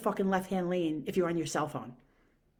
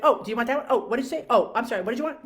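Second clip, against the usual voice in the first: higher and louder, rapid apologetic questions; imitating the clerk jumping in, higher; mock flustered clerk; 5 semitones higher, 7 dB louder, much faster than usual. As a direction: play her scattered and hurried.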